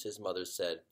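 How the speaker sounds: usual speaking voice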